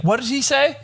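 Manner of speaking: high, curious coworker voice; nosy coworker. Retold or imitated. The coworker asking what the customer said, high and curious. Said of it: "she's like".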